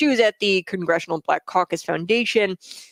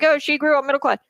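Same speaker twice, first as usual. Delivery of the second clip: mock objector; 9 semitones higher, 3 dB louder, faster than usual. Mimicking people who disagree with her.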